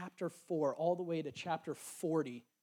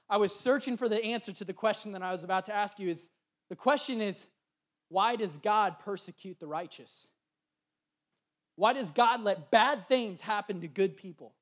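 preaching build; 4 semitones higher, 10 dB louder than usual. Real, not acted. Sermon builds to key question.

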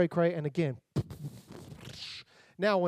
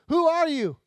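startled cry; 8 semitones higher, 7 dB louder than usual. Acted. Disciples frightened at sea.

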